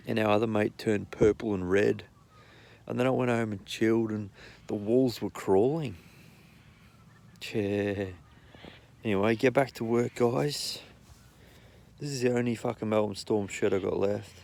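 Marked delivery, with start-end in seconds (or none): none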